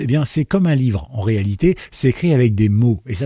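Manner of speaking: ordinary delivery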